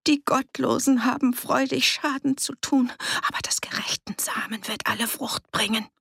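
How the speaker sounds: high, crazed reciting; strained, feverish conjuring; stammered incantation. A narrator's reading. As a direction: chant it as if out of your mind. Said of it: "Beschwörungen zu stammeln".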